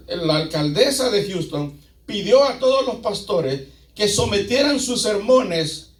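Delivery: ordinary delivery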